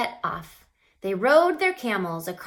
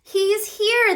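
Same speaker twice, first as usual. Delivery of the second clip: much higher, loud excited cry; joyful shout; 13 semitones higher, 9 dB louder than usual. A narrator's reading. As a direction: shout the good news. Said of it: "they shouted".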